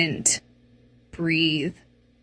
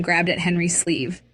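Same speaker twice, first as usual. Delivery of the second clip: ordinary delivery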